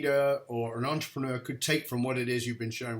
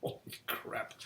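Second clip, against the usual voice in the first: stunned whisper; 13 dB quieter, slower than usual. Retold or imitated. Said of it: "I was like".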